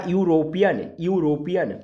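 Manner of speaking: यूरोपियन with a deliberate y-glide at the start; pronunciation demo. Shown as a demonstration. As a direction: sound out the "yoo" opening of यूरोपियन. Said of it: "effort बन रहा है". (यूरोपियन में शुरू का "यू" साफ़ बोलकर)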